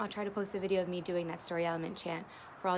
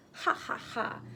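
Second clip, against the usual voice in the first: breathy taunting ha ha ha; mocking laugh; 4 semitones higher than usual. Stick pig taunting the wolf with airy laughter.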